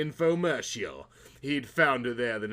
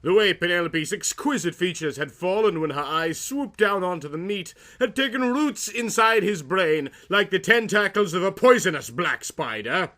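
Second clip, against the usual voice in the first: normal talking voice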